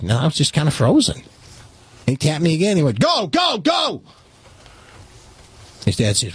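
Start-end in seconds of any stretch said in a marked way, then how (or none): 3.0-4.0 high urgent mock yell of go. Jump master yelling at the frozen recruit, high urgent yell. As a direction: shout him out of the plane.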